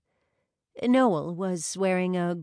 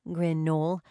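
ordinary delivery, nothing marked

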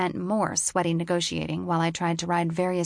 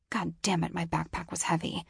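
whispered; 5 dB quieter than usual. A narrator's reading. Inner grumble under heavy pack.

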